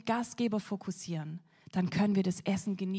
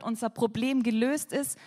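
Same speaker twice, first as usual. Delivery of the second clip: ordinary delivery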